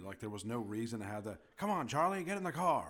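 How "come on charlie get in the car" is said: put-on old Hollywood accent, high and loud; projected parody of an old-film accent; old-movie accent. Mocking weird early film accents, loud old-movie accent. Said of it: "The accents were so fucking weird".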